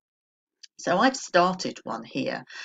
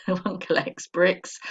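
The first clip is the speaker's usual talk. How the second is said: laughing; 3 semitones higher, slower than usual. Real, not acted. Laughing at her own tongue slip.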